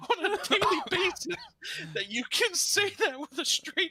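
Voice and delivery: high, incredulous retold protest; aghast disbelief. Applicant tells the manager he needs help, aghast. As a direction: plead in high-pitched alarm at his calm.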